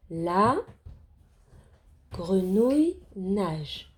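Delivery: slow, deliberate word-by-word reading; lecture mot à mot. Shown as a demonstration. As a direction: read slowly, pausing after each word or group. Teacher models reading word by word. (lecture lente, mot par mot)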